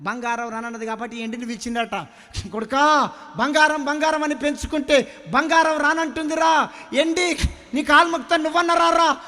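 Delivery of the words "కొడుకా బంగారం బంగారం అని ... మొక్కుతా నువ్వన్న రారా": father pleading with silver. Acted. Father begs silver to come.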